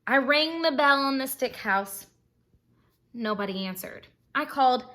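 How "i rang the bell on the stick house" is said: usual speaking voice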